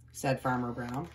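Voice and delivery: plain reading voice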